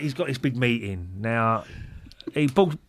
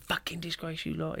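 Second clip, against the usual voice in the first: higher, softer mock-French swearing; French accent; 5 semitones higher, 10 dB quieter than usual. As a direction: imitate a calm Frenchman cursing, pitched up. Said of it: "in a French accent".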